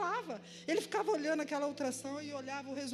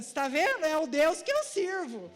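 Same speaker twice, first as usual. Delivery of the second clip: loud, triumphant proclamation; triumphant exclaim; 5 semitones higher, 6 dB louder, slower than usual. Retold or imitated. Telling coworkers God found her folder, loud and triumphant. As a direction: announce it to everyone like a victory.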